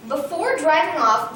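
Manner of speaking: ordinary delivery, nothing marked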